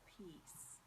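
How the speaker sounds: slow unvoiced whisper. Real, not acted. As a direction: breathe the word out slowly. Whispers card name.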